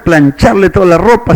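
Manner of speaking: usual speaking voice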